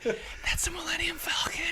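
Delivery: high breathless laughing whisper-shout; excited whisper-shout. Retold or imitated. To girlfriend in theater, giddy whispered shout. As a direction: hiss it out, giddy with recognition. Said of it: "I was like".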